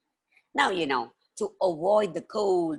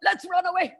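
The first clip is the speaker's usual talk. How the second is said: panicked fleeing; 13 semitones higher, much faster than usual. Acted. Chief urges his men to flee in panic. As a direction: yell it out while running. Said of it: "ran as fast as he could".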